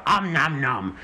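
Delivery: playful munching noise, demo; nom nom chomping. Shown as a demonstration. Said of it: "So you go".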